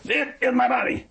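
high-pitched, pounding insistence; mock-strident declaration, each word driven home; emphatic Luther. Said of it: "Luther got somebody, took off his shoe, bam, bam, bam".

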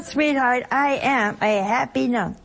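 ordinary talk, nothing marked

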